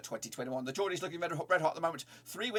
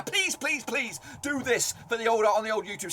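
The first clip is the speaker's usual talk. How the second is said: high, loud plea; pleading; 8 semitones higher, 6 dB louder than usual. Real, not acted. Host begging viewers to support his YouTube channel, pleading loudly. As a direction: beg them loudly, pitched right up.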